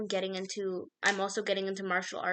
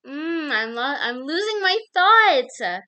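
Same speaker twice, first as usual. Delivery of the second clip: exasperated outburst; 6 semitones higher, 11 dB louder, slower than usual. Real, not acted. Exasperated at losing her thoughts.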